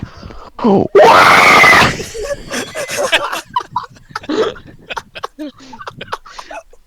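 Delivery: huge high war cry while swinging an axe; all-out excited battle yell; battle scream. Pretend. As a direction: scream it like a charging barbarian. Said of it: "the amount of passion you put behind that scream".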